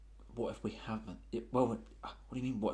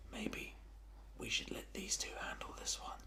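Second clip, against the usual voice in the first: whisper; 11 semitones higher, 9 dB quieter than usual. Acted. Leaning in to Varagon privately.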